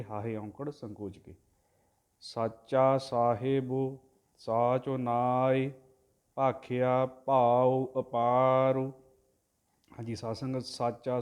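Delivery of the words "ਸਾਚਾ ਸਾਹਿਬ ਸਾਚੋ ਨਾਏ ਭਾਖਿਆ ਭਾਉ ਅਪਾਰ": drawn-out devotional chanting, word by word; slow santhiya recitation of scripture; slow Gurbani recitation. Shown as a demonstration. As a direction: chant each syllable slowly and evenly, like a granthi. Teacher chants the Gurbani line.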